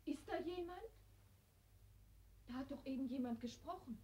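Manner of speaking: ordinary delivery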